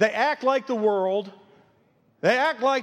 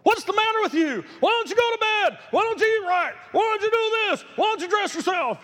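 mock yelling at a baby; 9 semitones higher than usual. Preacher imitating someone scolding an infant, high exasperated mock yell. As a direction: nag like a fed-up parent at a baby. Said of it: "somebody yelling at a baby".

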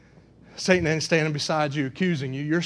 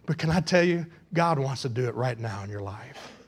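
low, soft pulpit appeal; earnest appeal; 4 semitones lower, 4 dB quieter than usual. Real, not acted. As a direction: bring it right down and press gently. Hushed appeal after loud climax.

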